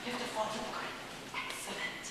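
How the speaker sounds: hushed direction to the actor; quiet aside. Real, not acted. Aside to a child.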